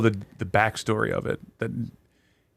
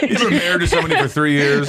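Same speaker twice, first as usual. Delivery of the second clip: ordinary delivery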